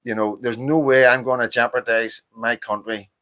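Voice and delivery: ordinary talk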